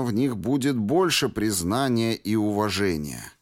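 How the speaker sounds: ordinary delivery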